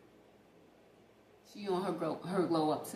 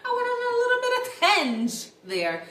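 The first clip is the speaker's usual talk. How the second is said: louder, higher, laughing through it; 7 semitones higher, 8 dB louder than usual. Real, not acted. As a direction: giggle at yourself for rambling. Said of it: "I almost forgot".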